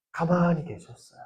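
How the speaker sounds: soft hushed fall again on 가만히. Acted. Preacher repeating to youth that Jesus stayed silent, hushed. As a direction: quietly let it land.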